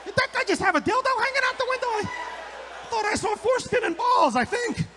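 a stunned stranger squeaking in disbelief; confused driver. Retold or imitated. Baffled driver asks about the window, high squeaky disbelief. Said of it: "They're like".

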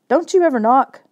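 startled and cross, snap it out; annoyed snap. Acted. Brother snapping at Kayla for barging in, annoyed.

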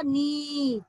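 नी with a drawn-out ee; long vowel. Shown as a demonstration. Teacher showing children what ना becomes, long-held नी. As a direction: hold the ee of नी. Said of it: "ना में बड़ी की मात्रा जोड़े".

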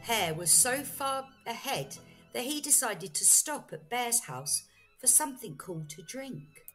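ordinary delivery

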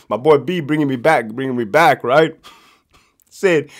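plain reading voice

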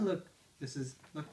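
usual speaking voice

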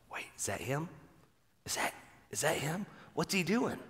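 whispered, wondering onlooker; a soft, awed whisper from the crowd; hushed crowd whisper. Retold or imitated. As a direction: breathe it like a stunned bystander. Onlookers murmuring at the river.

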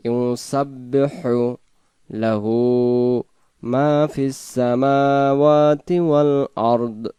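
slow melodic tajweed chant; Quran recitation. Acted. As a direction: recite slowly with long drawn vowels.

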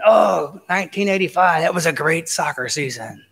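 lightly voiced old-timer reminiscing; mock a person stuck in glory days; a hint of a wistful boaster; nostalgic boaster. Mimics someone living in the past.

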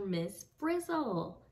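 ordinary delivery

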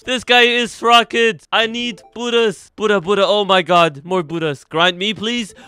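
high, loud hype shout; excited yelling. Real, not acted. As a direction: yell it out, hyped. Excited streamer yelling at players.

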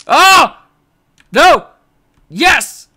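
loud, high yelps going from panic to triumph; frantic yelps. Real, not acted. Gamer reacting to a close jump, frantic yelps. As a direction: yelp through the near miss. Near miss, then success.

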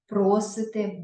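ordinary talk, nothing marked

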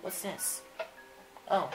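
ordinary talk

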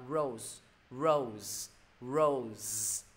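"rose" drawn out with a buzzing ending; model pronunciation. Shown as a demonstration. Teacher sharing a reading tip, careful drawn-out word. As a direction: hold the vowel of "rose", then sound the ending. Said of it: "Từ này đọc là".

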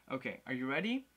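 ordinary talk

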